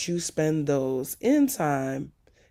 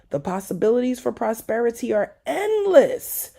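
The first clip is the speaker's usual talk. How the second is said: ordinary delivery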